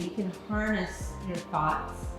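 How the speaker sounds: ordinary delivery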